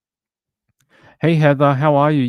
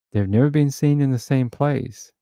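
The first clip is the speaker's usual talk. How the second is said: usual speaking voice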